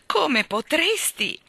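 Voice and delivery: high, slow, drawn-out wail; moaning. A narrator's reading. Miss Lark betrayed by her departing dog, moaning. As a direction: moan it reproachfully, stretching the words. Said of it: "gemette Miss Lark".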